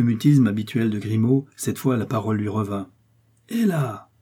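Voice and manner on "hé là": high indignant call; startled, high-pitched challenge; crying out. A narrator's reading. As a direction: call out sharply to stop an intruder. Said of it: "cria-t-il".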